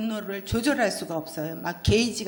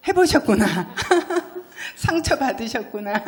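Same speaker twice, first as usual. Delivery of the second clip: laughing; 5 semitones higher than usual. Real, not acted. Preacher teasing her congregation, laughing. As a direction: laugh at the listeners' knowing reaction.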